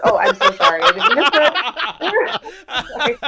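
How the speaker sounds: real embarrassed laughter through the apology; laughing apology. Real, not acted. Apologizing for swearing on air.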